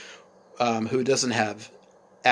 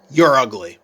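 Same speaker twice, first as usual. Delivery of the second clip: put-on teen yelling back, much louder; loud insult; 9 dB louder than usual. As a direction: hurl it at mom loudly. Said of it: "Betsy says back to her".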